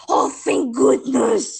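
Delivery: hearing it's a one-time thing, loud slow relief; huge relief. Retold or imitated. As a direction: let the relief pour out slowly. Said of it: "And I'm like".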